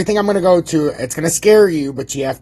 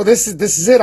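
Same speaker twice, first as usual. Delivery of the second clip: normal talking voice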